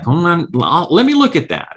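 lightly voiced salesman; imitating sales rep. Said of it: "he's like".

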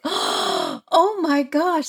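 slow, high, aghast; drawn-out high-pitched dismay, played for laughs; mock horror. Acts out horror at gory sight.

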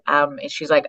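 ordinary delivery

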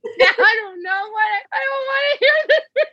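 high, laughing, near-shrieking panic; mock panic. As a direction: play the horrified parent, half laughing.

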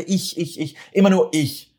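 mock exasperation on Immer nur ich; mocking repetition. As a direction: hammer every ich like an accusation. Mocking self-centred callers.

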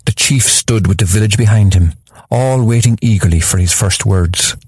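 usual speaking voice

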